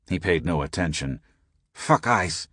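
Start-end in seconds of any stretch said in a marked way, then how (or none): none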